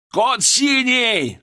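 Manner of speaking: high, drawn-out emergency yell; full-lung shout. A narrator's reading. Doctor calling an emergency code, full-lung shout. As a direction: bellow the code down the hall. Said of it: "заорал я во всю мощь лёгких".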